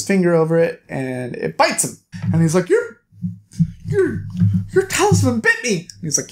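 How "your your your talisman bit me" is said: high-pitched, stammering mock shock; startled outrage. Bitten hero confronts the medicine man, mock startled. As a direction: stammer it out in a high squeal of disbelief.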